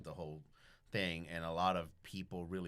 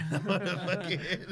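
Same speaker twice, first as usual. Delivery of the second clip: high, loud laughing through words; cracking up mid-sentence; 9 semitones higher, 8 dB louder than usual. Real, not acted. Laughing at his own prank idea.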